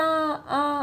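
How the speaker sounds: "आ" held long as a pluta vowel; drawn-out vowel. Shown as a demonstration. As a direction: sing out "आ" long and steady. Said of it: "लंबा बोला". ("आ" को लंबा खींचकर बोलना)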